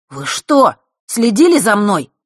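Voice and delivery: sudden horrified outcry. A narrator's reading. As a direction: caught off guard and appalled. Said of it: "ужаснулся Фэш".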